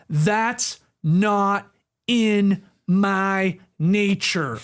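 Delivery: "That's not in my nature" with a pause after each word, very slow; gaps between words. Retold or imitated. Host reading a star player's quote, each word set apart. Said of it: "you're not emphasizing those four words".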